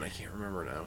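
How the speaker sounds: trailing off. Real, not acted. Forgets the artist's name.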